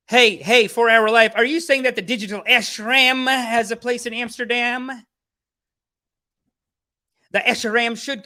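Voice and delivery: high, loud, thrilled call-out; excited. Real, not acted. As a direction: greet the viewer with a big burst of joy. Excited greeting to chat viewer.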